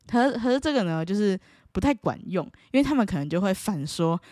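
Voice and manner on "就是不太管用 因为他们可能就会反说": usual speaking voice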